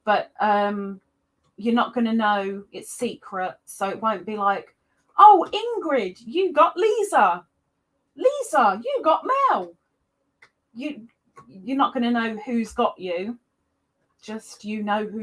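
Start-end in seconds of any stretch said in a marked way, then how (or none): 5.2-9.7 high, swooping gushy reveal; high, loud start with big falling swoops; mock announcement. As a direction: sing out the names with exaggerated delight. Acts out announcing Secret Santa names.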